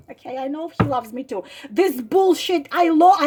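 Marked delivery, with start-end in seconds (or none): 0.1-1.4 fast, much softer, flat repeat; deadpan scorn. Real, not acted. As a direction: mutter it back in disgust.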